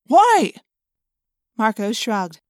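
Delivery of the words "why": high, loud challenge; demanding. A narrator's reading. Jake challenging Marco's decision, loudly demanding. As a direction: fire the question back. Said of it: "Jake demanded".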